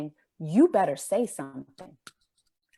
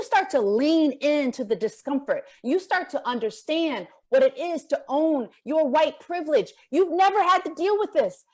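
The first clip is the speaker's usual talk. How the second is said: higher, building preacher's appeal; impassioned preaching; 7 semitones higher than usual. Acted. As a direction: drive the point home to the crowd. Impassioned address to audience.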